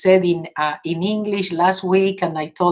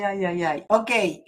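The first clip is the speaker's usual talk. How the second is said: normal talking voice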